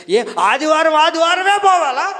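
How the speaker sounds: exaggerated mock grumble; mock complaint. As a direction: parody a reluctant churchgoer grumbling. Mocking lazy churchgoer's excuse.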